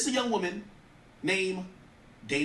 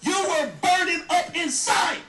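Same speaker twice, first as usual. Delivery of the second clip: louder, higher and slow shout; heated shout; 10 semitones higher, 6 dB louder, slower than usual. Real, not acted. Host telling Young Miami off, slow heated shout. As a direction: hammer it home, slowly.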